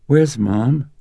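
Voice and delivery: put-on high little girl's voice, scared and tearful; a lost child calling for her mother, slow on the last words; tearful frightened child. A narrator's reading. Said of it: "She was tearful and frightened".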